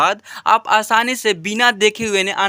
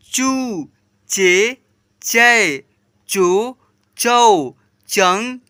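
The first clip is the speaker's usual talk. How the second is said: syllable recitation; much slower than usual. Shown as a demonstration. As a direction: chant the syllables one by one like a drill. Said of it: "ऐसे पढ़ेंगे और उच्चारित करेंगे". (लय में एक-एक अक्षर पढ़ना)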